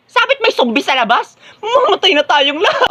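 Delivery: high-pitched frantic wail; panicked insistence. Acted. Student insisting zombies will kill everyone, panicked. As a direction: cry out in rising panic.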